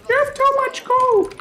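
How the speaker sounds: squeaky falsetto complaint; whiny shrill viewer voice; high squeaky mock voice. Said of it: "I know what you're thinking".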